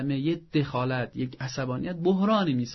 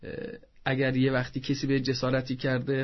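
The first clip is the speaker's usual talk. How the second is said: normal talking voice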